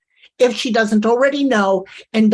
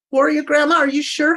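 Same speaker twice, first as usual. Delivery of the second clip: ordinary talk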